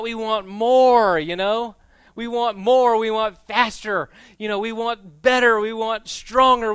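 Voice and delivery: high, loud, hammering preacher cadence; preaching at full force. Acted. A pastor driving home the greed of Christmas to the congregation, loud preaching. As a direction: pound each want like a chant.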